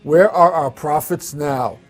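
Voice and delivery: big, booming show opening; announcer voice. Acted. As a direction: read it like a broadcast promo. Scripted show opening over music.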